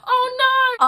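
high, loud gasp of "Oh no!"; playful exaggerated "oh no"; mock alarm. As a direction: yelp it like you just got caught. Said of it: "I'll be like".

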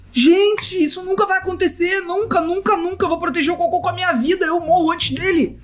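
high, intense promise; fervent vow. Real, not acted. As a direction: swear it with passion. Vowing to protect pet. (juramento intenso e agudo)

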